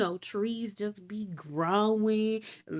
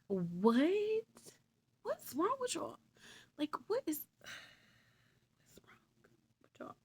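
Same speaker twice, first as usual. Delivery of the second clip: high exasperation fading into a whisper; exasperated; 10 semitones higher, 4 dB quieter, slower than usual. Real, not acted. Narrator exasperated at the crowd, high then fading to a whisper. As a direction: disbelief that trails off to a whisper.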